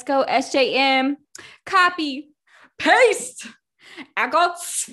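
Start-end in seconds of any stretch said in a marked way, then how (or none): 4.2-4.9 "echoes" raised high with an upturned ending; oddly said echoes. Real, not acted. Co-hosts reading out a chant, oddly lifted "echoes". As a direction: lift "echoes" oddly at the end. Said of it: "Why did you say it like that?".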